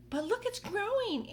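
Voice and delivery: high, excited aunt voice; excited Aunt Spiker. Acted. Aunt Spiker gawking at the growing peach, high and excited. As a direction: squeal it as the greedy aunt. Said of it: "Aunt Spiker said".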